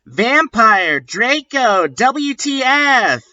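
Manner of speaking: pitched high, fed-up question; high exasperated asking. Acted. She asks the fighting boys, high and exasperated. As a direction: demand it, voice up high. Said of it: "I asked".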